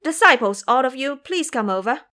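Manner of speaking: monk summoning his disciples; calling out. Acted. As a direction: call the disciples in. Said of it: "San Zhang then called".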